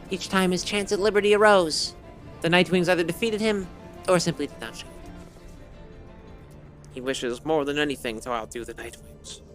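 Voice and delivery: ordinary delivery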